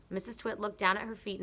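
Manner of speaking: ordinary delivery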